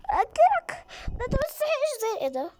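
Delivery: high, loud panicked cries; terrified shrieking. Real, not acted. Scared player shrieking at the creepy game, crying out. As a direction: shriek in real fright, near tears.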